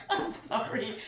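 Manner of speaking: laugh, then apologise through it; laughing apology. Real, not acted. Apologising to viewers through laughter.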